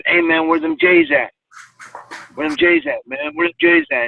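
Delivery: high, nagging friend voice repeating the question; pestering friend. Retold or imitated. Friend badgering the narrator about his shoes, nagging high voice.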